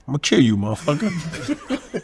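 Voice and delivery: low, slow threat through a laugh; low slow menace. Retold or imitated. Boxer mocking Mitch Green, low slow threat. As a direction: growl it under your breath, slow. Michael's glare, voiced menace.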